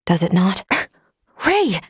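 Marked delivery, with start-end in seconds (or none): 0.7-1.8 startled gasp. Acted. Android startled by stare.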